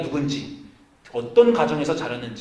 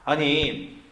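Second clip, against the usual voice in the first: ordinary talk, nothing marked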